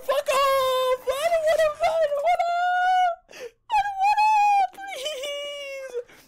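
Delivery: high wail climbing into a cry. Real, not acted. Player begging the monster to go away, shrieking. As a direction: plead in a high-pitched, tearful shriek. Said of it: "screaming like a girly girl".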